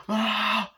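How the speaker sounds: high imitated scream of dread; drawn-out high "ah" of mock terror. Acts out screaming at sunlight.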